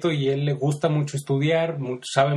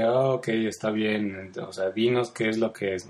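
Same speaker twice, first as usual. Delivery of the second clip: grudging; 5 semitones lower, 3 dB quieter than usual. Retold or imitated. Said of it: "un poco a regañadientes".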